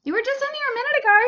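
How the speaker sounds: fast, high, loud scolding; fast indignant. Real, not acted. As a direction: rattle it off indignantly. Scolding husband who left.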